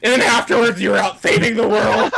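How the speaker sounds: high shouting whiny hero. Retold or imitated. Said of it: "I wouldn't be like".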